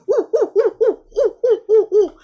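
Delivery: loud, high monkey hoots, woo woo woo. Retold or imitated. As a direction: jump around hooting like a little monkey. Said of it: "jump across the room going".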